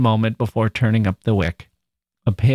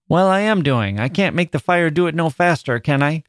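defensive retort; 6 semitones higher than usual. A narrator's reading. Girl answers her brother's complaint.

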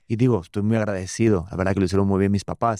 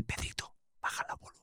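whisper, much softer than usual talk; hushed reprimand; 14 dB quieter than usual. Retold or imitated. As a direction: discreetly whisper to shush a child. Retells being hushed in public.

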